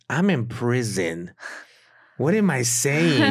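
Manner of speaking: slow, rising disbelief at himself; incredulous. Retold or imitated. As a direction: stretch it out, baffled by your own words. Retells his own ultimatum in disbelief.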